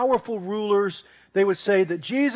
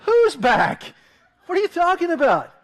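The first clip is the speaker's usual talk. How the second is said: higher, louder and puzzled, a laugh breaking in; confused Ken; 9 semitones higher, 7 dB louder than usual. Retold or imitated. Ken asks the screaming neighbor who's back, baffled. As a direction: act baffled and amused. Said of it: "He's like".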